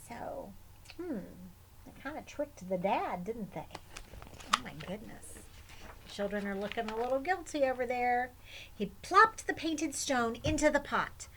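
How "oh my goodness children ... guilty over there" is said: plain reading voice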